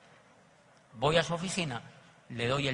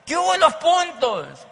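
high, loud, slow mock nag; a sponsor nagging a recruit about his points; 12 semitones higher, 9 dB louder, slower than usual. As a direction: pester him month after month. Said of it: "tienes cada mes que estarle diciendo".

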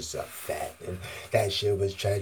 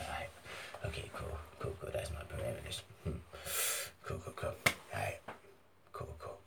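1 quiet muttering; 5 semitones lower, 11 dB quieter, faster than usual. Real, not acted. Muttering to himself, planning.

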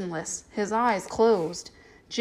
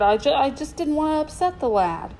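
higher, stammering mild whimper; 5 semitones higher than usual. A narrator's reading. Cornered man makes excuses to the strong man, whimpering. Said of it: "Tupper whimpered".

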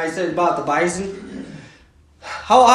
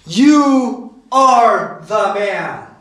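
slow, weighty accusation, word by word; lowering the boom; much slower than usual. Acted. Prophet confronting David over his sin, slow and damning. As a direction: spell out the verdict deliberately, no praise in it. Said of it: "Nathan just basically lowers the boom on him".

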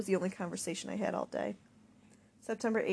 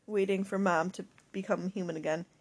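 plain reading voice

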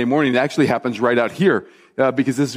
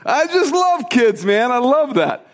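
delighted; 8 semitones higher, slower than usual. Real, not acted. Delighted after kids story.

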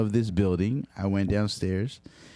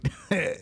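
short laugh; 6 semitones higher than usual. Real, not acted. Laughing after the insult.

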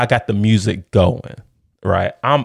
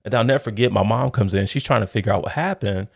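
ordinary delivery

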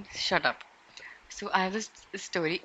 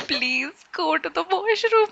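pleading; 9 semitones higher, 4 dB louder, slower than usual. Retold or imitated. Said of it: "I was like".

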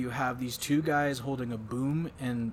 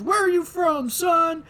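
much higher and louder barking question; drill instructor yelling; 11 dB louder, slower than usual. Retold or imitated. Host imitating the drill instructor, loud high bark. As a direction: bellow like a drill sergeant. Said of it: "the drill instructor's yelling at him".

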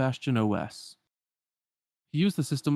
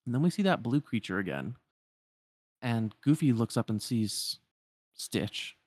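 ordinary delivery

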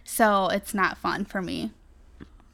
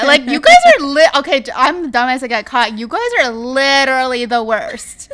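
real exasperated yelling, much louder and higher; loud outburst; 4 semitones higher, 13 dB louder than usual. Real, not acted. Sister yells at her sisters who tease her about her arrest. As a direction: blow up at your sisters' teasing. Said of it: "You are gonna make our audio sound terrible".

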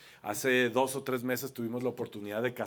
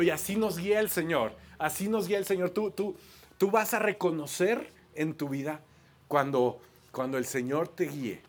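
normal talking voice

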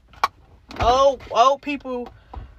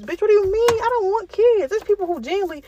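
outraged; 9 semitones higher than usual. Real, not acted. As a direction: fire back in disbelief. Outraged reaction to tube tie rule.

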